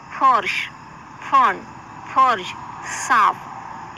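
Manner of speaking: फर्श, फन, फर्ज, साफ with a lip-teeth f; nukta f sounds. Shown as a demonstration. Teacher shows students the f sound in फर्श, फन, फर्ज, साफ. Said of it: "फ को नुक्ता लगाएंगे तो".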